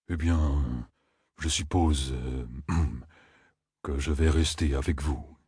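low, subdued, reluctant rambling; hesitant Loyal. A narrator's reading. Gentle giant decides to stay with the group, low and hesitant.

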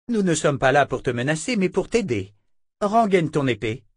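soothe, then order; sister Verna. A narrator's reading.

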